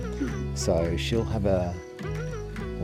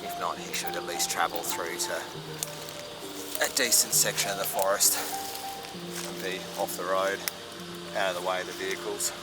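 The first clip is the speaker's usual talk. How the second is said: hushed, softer wildlife narration; nature documentary; 7 dB quieter than usual. Real, not acted. Hushed talk beside a wild koala.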